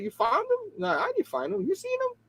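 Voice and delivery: high, soft imitation of a woman; lady's voice. Lady asking about Spider-Man, high soft voice. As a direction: lift into a high, quiet woman's voice. Retelling a lady searching for him.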